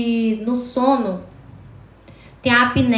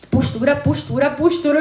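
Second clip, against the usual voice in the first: self-coaching; 3 semitones higher than usual. Retold or imitated. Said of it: "Aí eu falei assim".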